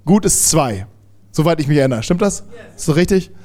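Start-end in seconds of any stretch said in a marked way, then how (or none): none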